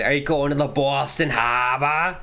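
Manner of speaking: put-on repeated jokey Boston accent, no r in harbor. Streamer repeats his one Boston accent line. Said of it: "only thing I can say in a Boston accent".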